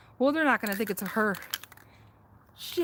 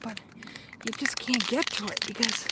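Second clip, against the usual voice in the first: ordinary talk, nothing marked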